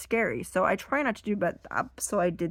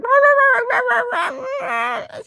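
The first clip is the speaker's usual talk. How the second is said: loud, high mock whimper; exaggerated doggy yammering; imitated dog whimpering; 9 dB louder than usual. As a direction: wail like a tiny upset dog. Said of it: "she was whimpering".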